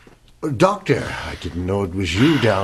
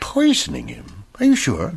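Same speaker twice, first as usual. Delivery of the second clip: high, startled question; stunned at the news; shocked disbelief; 8 semitones higher than usual. Acted. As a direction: caught off guard, voice jumps up. Doctor hears of arsenic poisoning.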